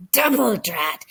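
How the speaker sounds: small exasperated exclamation; mildly voiced mouse muttering a curse; frustrated mouse. A narrator's reading. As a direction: grumble it like a cross little mouse.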